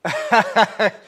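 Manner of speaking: loud, high burst of laughter; real cackling laugh, high and loud; hearty laugh. Real, not acted. Painter amused by a livestream viewer's comment, hearty laugh.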